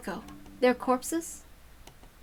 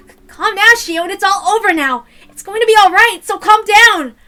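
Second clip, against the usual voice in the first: urgent shout; 10 semitones higher, 13 dB louder than usual. Acted.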